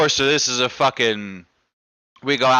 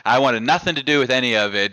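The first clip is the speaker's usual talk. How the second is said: normal talking voice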